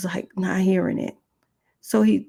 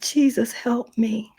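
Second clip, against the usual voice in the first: high, subdued cry for help; pleading to Jesus; 6 semitones higher, slower than usual. Retold or imitated. Woman recalls praying under attack, high pleading call. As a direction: retell the plea with emotion. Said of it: "I called on the name of Jesus".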